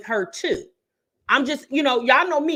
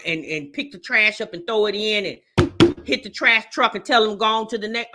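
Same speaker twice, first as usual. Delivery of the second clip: usual speaking voice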